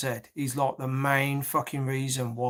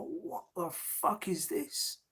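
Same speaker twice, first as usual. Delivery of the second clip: whispered disbelief; 5 semitones higher, 9 dB quieter, slower than usual. Retold or imitated. Retelling his reaction to random claims.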